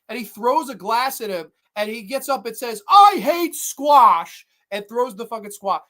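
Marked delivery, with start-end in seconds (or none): 2.9-4.5 mock over-the-top husband. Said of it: "he's acting so over the top".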